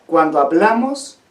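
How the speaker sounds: usual speaking voice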